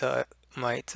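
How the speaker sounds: normal talking voice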